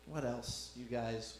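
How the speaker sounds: ordinary talk, nothing marked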